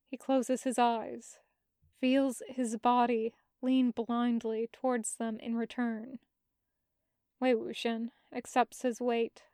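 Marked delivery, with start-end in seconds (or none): none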